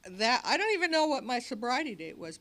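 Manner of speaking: usual speaking voice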